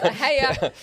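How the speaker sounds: high, loud hello through laughter; laughing greeting. Real, not acted. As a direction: say it while still laughing.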